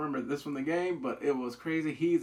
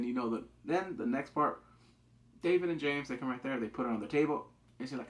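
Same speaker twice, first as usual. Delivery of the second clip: ordinary talk, nothing marked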